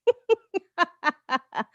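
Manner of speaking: a short high giggle at herself; light self-amused chuckle; amused laugh. Real, not acted. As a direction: laugh off your own joke. Laughs at own vanity joke.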